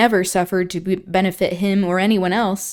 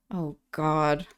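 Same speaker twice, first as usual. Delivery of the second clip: ordinary delivery, nothing marked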